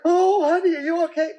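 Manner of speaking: high, loud over-anxious coo; mock worried mother fussing; fussy parent. Parent fussing over fallen kid.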